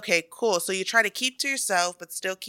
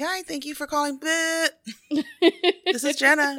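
high, chirpy phone greeting; fake-bright phone answer; customer service voice; 8 semitones higher than usual. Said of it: "your fake customer service voice".

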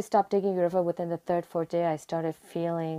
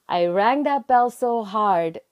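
emphatic joy; 4 semitones higher, 6 dB louder, slower than usual. Real, not acted. Recalling the joyful last chemo day.

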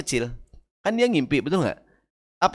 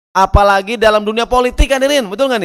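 loud rousing emphasis; 7 semitones higher, 6 dB louder than usual. Real, not acted. Preacher raising voice.

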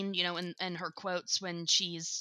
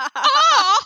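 high, loud laughing yelp; Luann squeal retry; 15 dB louder than usual. Retold or imitated. Hostess retries the Luann yelp, laughing. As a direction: try the squeal again, breaking into laughter. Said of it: "No, that's not even it".

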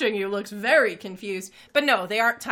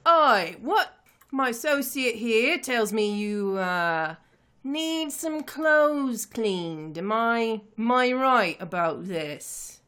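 drawn-out, questioning boss character voice; crime boss; slower than usual. Acted. Boss addressing strangers about cleaning clothes, drawn-out character voice. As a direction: size them up as the man in charge. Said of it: "and he's like".

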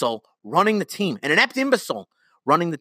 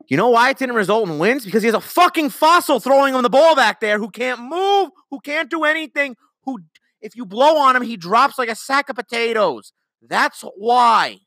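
higher and louder, furious venting; angry rant; 8 semitones higher, 4 dB louder than usual. Real, not acted. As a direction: blow up at the fossil quarterback. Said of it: "ranting up a fucking storm".

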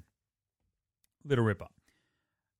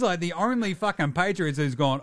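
ordinary talk, nothing marked

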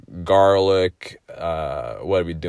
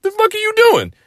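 much higher, louder scolding shout; high furious shriek at his mom; furious yell; 7 dB louder than usual. Retold or imitated. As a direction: scream it at your mom. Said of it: "I was like".

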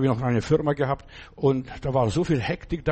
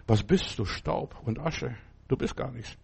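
hushed verdict; 6 dB quieter than usual. Acted. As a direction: let the words sink to a hush. God's verdict on man, hushed.

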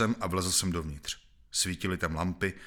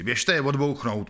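louder, higher, slow order; harsh order; 6 semitones higher, 5 dB louder than usual. A narrator's reading. A man in the crowd orders the prisoners shot, loud and slow. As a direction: bark it out slowly. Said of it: "pokračoval hlas".

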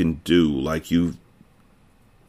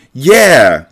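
loud, higher-pitched cheer; cried out, brightening; 5 semitones higher, 8 dB louder than usual. A narrator's reading. The kids agree to keep searching, crying out happily. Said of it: "cried the others, brightening".

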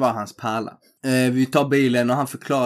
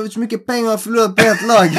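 much higher, louder and fast; imitating a worked-up cop; 9 semitones higher, 6 dB louder, faster than usual. Cop complains about money lost on his team, higher, louder, fast. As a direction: rant it like a burned gambler.

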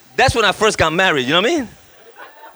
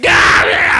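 high, loud mock wail; imitated crybaby howl; crying like a baby; 9 semitones higher, 5 dB louder than usual. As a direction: bawl out to God, hammed up. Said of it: "I started crying like a baby".

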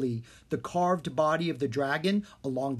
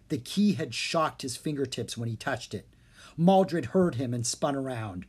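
usual speaking voice

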